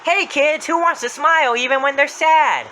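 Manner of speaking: put-on high, loud call to a crowd of kids; Happy's voice. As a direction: holler it out like a children's entertainer. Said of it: "He got out of his van and said".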